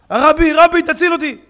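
high, loud crying out; shipwrecked man begging rescue; frantic shout for help; desperate plea. Acted. Drowning man begs rabbi.

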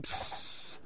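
long voiceless hiss; deflating "pshhh" sound; air let out. Acted. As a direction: hiss softly like escaping air. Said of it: "כולם מוציאים אוויר".

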